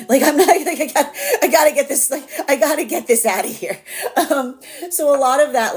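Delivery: high, loud laughter through the words; laughing. Real, not acted. Reader laughing about urgent feminine energy, high and loud. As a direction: laugh it out, amused.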